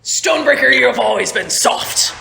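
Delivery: loud, scornful rebuke; high, forceful captain's outburst; Captain Merrick. Acted. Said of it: "He's like".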